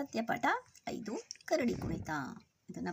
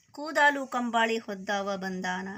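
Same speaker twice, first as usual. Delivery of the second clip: slow, louder verse chant; poem recitation; 5 dB louder than usual. Acted. Teacher repeats the blanket line to children, slow chanted recitation. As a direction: stretch the verse out rhythmically.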